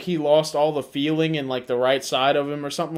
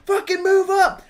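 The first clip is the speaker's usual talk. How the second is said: high, shouted angry orders; screaming at kids; 13 semitones higher, 6 dB louder than usual. Retold or imitated. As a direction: imitate an irate teammate yelling orders. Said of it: "you're screaming at kids".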